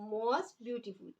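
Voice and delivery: very slow, each word drawn out for writing; slow dictation. Shown as a demonstration. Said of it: "beautiful की बनेगी". (बहुत धीरे-धीरे लिखवाते हुए)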